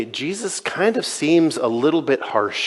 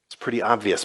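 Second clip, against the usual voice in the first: sarcastic duh; 4 semitones lower, 5 dB quieter than usual. Retold or imitated. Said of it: "they're like".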